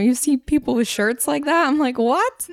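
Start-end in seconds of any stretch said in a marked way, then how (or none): 2.0-2.5 high-pitched, taken-aback reaction; incredulous. Retold or imitated. Host reacting to shirts praising sarcasm, incredulous. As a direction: act out baffled disbelief.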